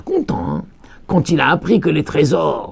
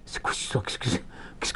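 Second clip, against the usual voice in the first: much softer, whispered wondering; puzzled whisper; 9 semitones lower, 15 dB quieter, faster than usual. Acted. Rabbi wondering aloud to listeners in a hushed whisper.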